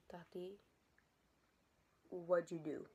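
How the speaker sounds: soft, low, halting whisper; hushed question. Retold or imitated. Sister asking teller what she did, hushed whisper. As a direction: whisper it low with a pause midway.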